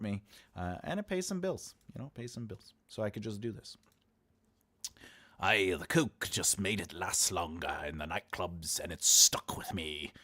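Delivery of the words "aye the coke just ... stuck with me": put-on slow, drawn-out pirate accent; stagey pirate brogue. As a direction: growl it out like a movie pirate. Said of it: "I said it as a pirate".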